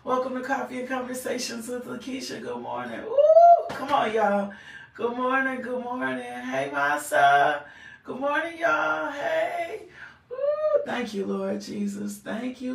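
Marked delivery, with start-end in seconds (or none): 3.0-3.6 a sudden high, loud squeal of delight; excited ooh. Real, not acted. Host greets viewers joining live. 10.3-10.8 delighted ooh. Real, not acted. Host thanks God on air.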